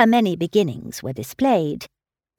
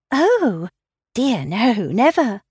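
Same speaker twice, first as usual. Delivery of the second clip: a touch of naive shyness, higher; 4 semitones higher, slower than usual. Acted. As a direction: innocent girl shying away from praise. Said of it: "with a very interesting naivete".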